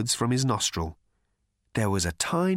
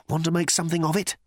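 quick, raised-pitch dare; fast, higher challenge; feeble challenge; 6 semitones higher, much faster than usual. A narrator's reading. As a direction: pick a fight in a hurry. Arthur challenges Thor, tiny fists raised.

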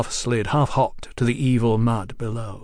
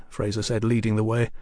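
ordinary delivery

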